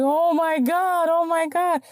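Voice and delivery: high, drawn-out mock exclamation; imitating amazement. Man learning she heals for a living, imitated amazed cry. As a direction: stretch it out high, mimicking his astonishment. Retold amazed reaction to healer reveal.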